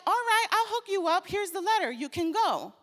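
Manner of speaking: high, loud, fast cool-buddy king; breezy, upbeat, high and quick; easygoing king. Retold or imitated. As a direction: toss it off like a friend doing a favour. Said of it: "the king is like".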